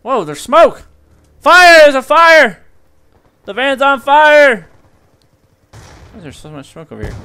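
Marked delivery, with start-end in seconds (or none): 0.0-4.7 high, loud alarm, slowing at the end; alarmed yelling. Real, not acted. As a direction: shout the alarm to the team. Spots a burning van.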